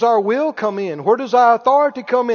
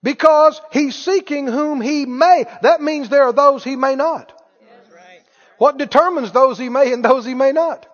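ordinary talk, nothing marked

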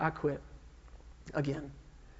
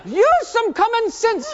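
high, slow, very loud mock scolding; yelling instructor; 11 dB louder, slower than usual. Instructor from India to struggling student pilot, yelling. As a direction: yell it in an imitated Indian accent. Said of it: "he's yelling at me in this".

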